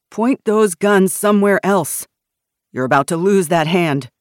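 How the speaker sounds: loud warning in an armed standoff; forceful threat. A narrator's reading. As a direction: warn them loudly and firmly.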